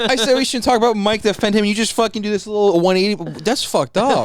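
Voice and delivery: loud, high, mock-hurt protest; indignant complaint. Real, not acted. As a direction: protest loudly that it's unfair.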